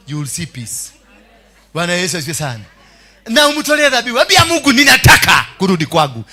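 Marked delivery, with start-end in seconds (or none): 3.3-6.2 loud, raised exhortation; preacher's loud call. Real, not acted. Preacher urging congregation to tell God they want to return, shouted. Urges congregation to pray aloud.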